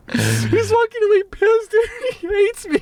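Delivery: very high, slowed by real laughter; dying laughing. Real, not acted. Storyteller cracking up telling friend about attendant, real laughter. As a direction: squeak the words out through laughter. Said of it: "I'm fucking dying laughing".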